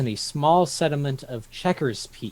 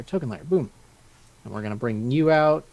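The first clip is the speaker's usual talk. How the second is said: ordinary talk, nothing marked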